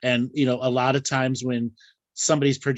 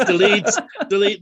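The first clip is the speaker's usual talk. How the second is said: laughing; 8 semitones higher, 5 dB louder than usual. Pretend. Mock sorting lewd pictures.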